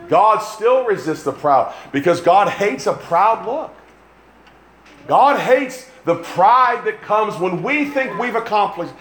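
high, slow, emphatic sermon cadence; impassioned preaching. Real, not acted. Preacher denouncing pride to the congregation, impassioned. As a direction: lean hard on each repeated phrase. Preacher drives his point home.

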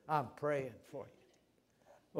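hushed, very slow, barely voiced; soft murmur. Shown as a demonstration. Pastor softly praying over a brother, hushed and slow. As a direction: let it fade to almost nothing. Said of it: "repeats the phrase ever softer".

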